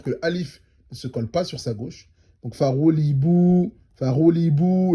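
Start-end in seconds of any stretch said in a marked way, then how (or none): 2.5-4.9 slow farouli bou with the bou drawn out; long vowel held. Shown as a demonstration. Teacher drilling the held vowel. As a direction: hold the final bou long. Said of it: "on prolonge".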